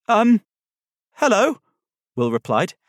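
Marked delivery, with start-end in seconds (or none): 0.1-1.6 high, loud, hesitant greeting rising at the end; startled boy's questioning hello; startled squeak. A narrator's reading. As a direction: squeak it out, caught off guard. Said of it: "a much more startled squeak than he was expecting".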